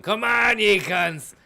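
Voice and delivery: high, loud mock battle cry; imitated mad yell. Imagining a panicked man running around, mock yell. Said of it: "you just ended up fucking running around going".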